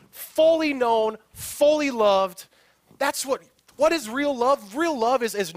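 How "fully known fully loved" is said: slow emphasis. Real, not acted. Emphatic slow phrase in lesson.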